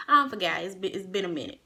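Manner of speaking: ordinary delivery, nothing marked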